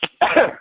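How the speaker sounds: sharp real cough; coughing fit. Real, not acted. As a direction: hack out a stuck bug. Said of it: "I think I swallowed a fly".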